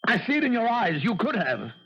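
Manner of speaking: high, pained accusing cry; blaming his friend, voice straining up; anguished accusation. Acted.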